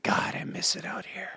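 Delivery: breathy unvoiced whisper, wistful; whispered Liotta quote. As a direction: hush it like a man missing the field. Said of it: "He's like".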